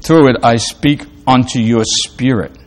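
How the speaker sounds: quiet, fading voice of God giving peace; gentle divine voice. Acted. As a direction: let it sink to a hush as you bless them. God speaking peace to listener.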